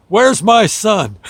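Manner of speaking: a worried dad barking out his demand; angry demand. Retold or imitated. Dad bursting into the ER demands his son, loud and slow.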